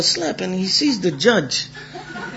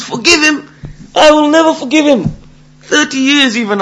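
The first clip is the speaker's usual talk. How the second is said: high, loud acted outrage; indignant refusal; 8 semitones higher, 4 dB louder than usual. Slapped man refusing the judge's plea, indignant. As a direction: refuse hotly, pitch jumping right up.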